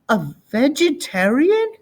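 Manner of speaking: put-on high, puzzled little boy; a small kid getting the word wrong; boy questioning the big word; child's voice.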